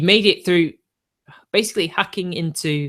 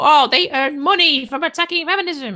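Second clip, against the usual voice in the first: much higher mocking voice of his critics; mocking quote; 12 semitones higher than usual. Streamer mimicking critics who accuse him, high mocking falsetto. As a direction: parrot their complaint in a sneering falsetto. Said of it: "They always talk about how".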